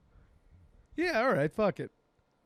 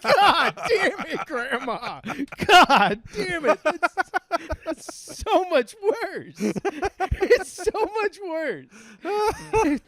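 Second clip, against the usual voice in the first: high, loud, drawn-out wail through laughter; slow, high laughing howl; exasperated mock outburst; 12 semitones higher, 6 dB louder, much slower than usual. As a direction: wail it in mock despair while cracking up. Retold outburst at Grandma, cracking up.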